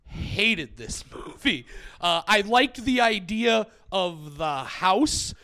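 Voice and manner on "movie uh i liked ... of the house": ordinary delivery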